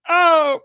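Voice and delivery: high, loud cartoon "oh!"; Luigi. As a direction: imitate a startled cartoon yelp. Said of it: "Luigi's O".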